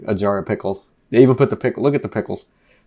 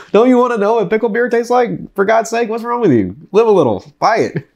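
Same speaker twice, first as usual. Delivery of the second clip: much higher, hammed-up pushy salesman; mock pushy pitch; 11 semitones higher than usual. Urging viewers to just buy the pickle beer, high mock pushy voice.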